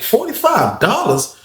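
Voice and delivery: slow, high, disbelieving repeat; incredulous. Retold or imitated. Narrator shocked at being asked for money, slow incredulous echo. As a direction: drag it out in disbelief.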